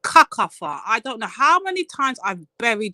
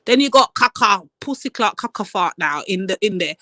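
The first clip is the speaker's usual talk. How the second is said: normal talking voice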